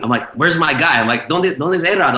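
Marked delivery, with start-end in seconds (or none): 0.4-1.0 retold panic, raised pitch; panicked searching. DJ looking for his missing manager mid-set, panicked. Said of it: "looking for him in a panic". 1.3-2.2 retold panic, high and urgent; panicked searching. DJ asking club staff for missing A-Rod, panicked. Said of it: "looking for him in a panic".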